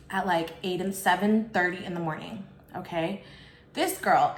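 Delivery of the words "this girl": ordinary delivery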